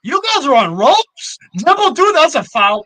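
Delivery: high, loud, excited heckling; heated match reaction. Real, not acted. Fan tells rivals they are on ropes, excited heckle. Heckling live play, claiming a foul.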